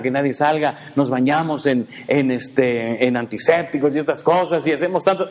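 normal talking voice

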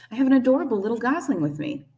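high, quick, cheerful call; loud, friendly robot voice; friendly booming. A narrator's reading. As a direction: announce it brightly across the water. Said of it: "the robot's friendly voice boomed".

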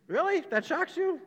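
high, loud mock surprise; mock incredulous. Preacher scoffing at congregation's shock, high and loud.

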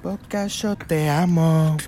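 high, slow Argentine devotion; mock Argentine fan. Football fan declaring love to Boca, mock Argentine.

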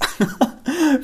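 a burst of high laughter; quick high laugh of gratitude. Real, not acted. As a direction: laugh it out, moved and happy. Laughs while thanking Vreni.

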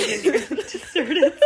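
real laughter breaking into the read; trying to read on through giggles; laughing while reading. Real, not acted. Cracks up while reading the summary.

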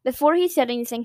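ordinary delivery

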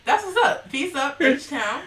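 high, loud hype chant. Retold or imitated. Quoting [name]'s hype call to the co-host. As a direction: shout it like a club call. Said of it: "That's from [name]".

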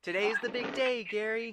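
high cartoon voice; SpongeBob. SpongeBob greeting his pet snail, high cartoon voice. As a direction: chirp it in a high put-on voice.

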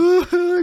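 a burst of high laughter at himself; short high laugh. Real, not acted. Laughing at himself while telling his friend. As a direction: laugh out, amused at his own story.

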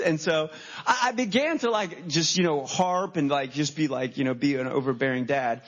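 ordinary delivery

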